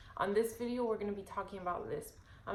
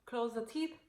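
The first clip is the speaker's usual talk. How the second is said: bright cheerful teacher cue; coaching a child; 6 semitones higher, slower than usual. Shown as a demonstration. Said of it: "So I'll tell them".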